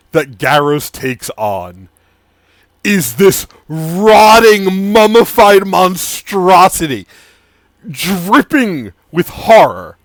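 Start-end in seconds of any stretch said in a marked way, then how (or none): 2.8-10.0 horror build. A narrator's reading. GM describing the rotting monstrosity with relish. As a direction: savour the gross reveal.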